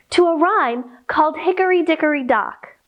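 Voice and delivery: ordinary talk, nothing marked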